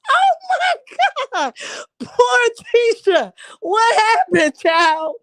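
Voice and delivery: howling with laughter; laughing shriek. Real, not acted. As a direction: holler it through tears of laughter.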